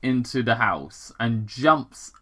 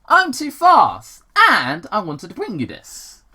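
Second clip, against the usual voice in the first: put-on cocky young Sonic; 8 semitones higher, 6 dB louder than usual. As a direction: stretch it out high, bragging. Young Sonic boasting to Longclaw.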